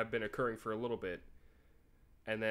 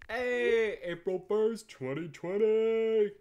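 much higher and louder, drawn out slowly; loud sing-song read; 9 semitones higher, 10 dB louder, much slower than usual. Pretend. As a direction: call the date out like an announcement. Reading old message aloud.

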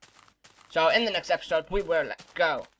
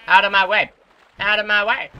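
loud high shout to clear the path; out of my way, shouted at the mobs; yelling; 6 semitones higher, 13 dB louder than usual. Real, not acted. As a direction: bellow it, barging through. Charging through mobs yelling.

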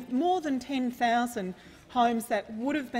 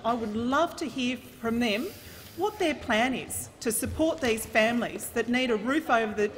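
ordinary talk

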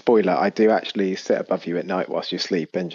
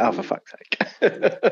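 exasperated; 10 semitones higher, much slower than usual. Retold or imitated. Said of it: "And you're like".

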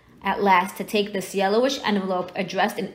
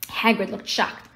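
ordinary delivery, nothing marked

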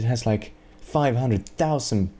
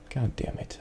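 muttered curse; 8 dB quieter than usual. Real, not acted. Frustrated gamer swearing at puzzle.

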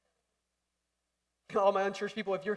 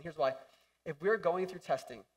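ordinary delivery

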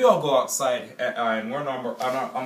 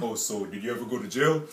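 interrogating dad; 2 semitones lower than usual. Retold or imitated. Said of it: "interrogate the Smashers".

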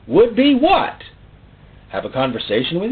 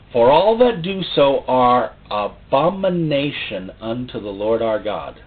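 scripture reading; 5 semitones higher than usual. Shown as a demonstration.

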